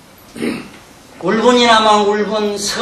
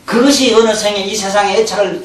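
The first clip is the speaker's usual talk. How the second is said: normal talking voice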